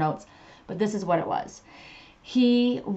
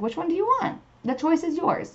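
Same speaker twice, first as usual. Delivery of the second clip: lightly voiced spirit guide, pitched much higher; playful Spirit voice; 10 semitones higher than usual. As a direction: play the guide as teasing and airy, up high. Spirit voiced in retold story.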